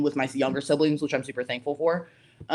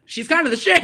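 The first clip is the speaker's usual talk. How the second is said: higher, louder retold hype; impressed buddies; 12 semitones higher, 7 dB louder than usual. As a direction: punch it up as an impressed buddy. Voicing dad's impressed work buddies.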